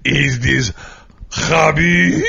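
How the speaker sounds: Russian accent Putin impression. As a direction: put on a heavy Russian accent. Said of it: "am I doing a Russian accent".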